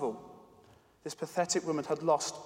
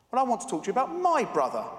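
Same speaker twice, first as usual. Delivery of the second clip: higher, louder and fast, indignant; annoyed tone; 7 semitones higher, 5 dB louder, faster than usual. Acted. Girl answering the pharmacist back, annoyed. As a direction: fire it back at him, insistent. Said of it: "in the same annoyed tone".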